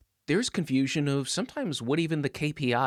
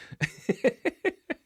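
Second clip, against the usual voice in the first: high laugh; 13 semitones higher than usual. Real, not acted. Laughing at own punchline.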